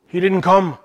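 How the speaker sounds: light imitated Irish brogue on "He didn't come"; a hint of an Irish lilt, as if quoting her. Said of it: "at least in her brogue".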